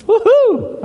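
high-pitched celebratory woohoo; high cheering hoot; high whoop. Real, not acted. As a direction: let out a quick, joyful whoop. Preacher cheers after verse.

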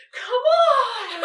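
high whiny yell, laughing; whiny shout. Retold or imitated. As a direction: whine it out loud like a scared kid. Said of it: "really whiny".

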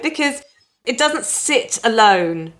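ordinary talk, nothing marked

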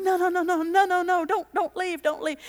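put-on high, pleading girls' voices; panicked bridesmaids begging the matron to stay. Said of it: "the girls are going".

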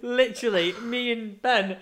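high and loud, laughing while telling; can barely talk for laughing. Real, not acted. Recalling the curry house, laughing hard and high. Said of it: "I was crying at the table".

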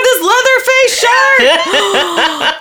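slow, high excited shriek with laughter; yelling into mic. Real, not acted. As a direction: scream with delight into the mic. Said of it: "stop yelling into the microphone".

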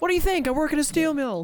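high-pitched playful comeback, breaking into laughter; joking mock retort. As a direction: play indignant for laughs, pitch way up. Joking in the embarrassed man's voice.

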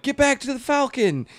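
high-pitched mock urgent order; imitated film line. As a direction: call it out like an overacted movie line. Quotes his one urgent film line.

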